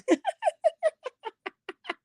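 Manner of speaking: breathless high-pitched giggle; shrieking into a laugh; high squealing laugh. Real, not acted. Host cracks up at a viewer's comment, squealing laugh. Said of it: "I'm so tickled".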